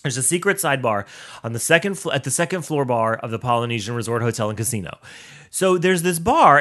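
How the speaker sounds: usual speaking voice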